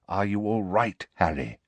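put-on low, soft, gentle old headmaster; quietly checking he is unhurt; Dumbledore's low concern. Old headmaster asks fallen boy gently, low and soft.

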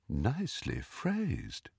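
soft, slow, high praise; gentle, dreamy door-knocker voice; musical voice. A narrator's reading. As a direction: murmur approval with a lilt. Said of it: "the musical voice".

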